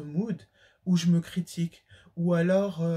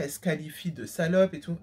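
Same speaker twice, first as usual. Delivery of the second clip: ordinary talk, nothing marked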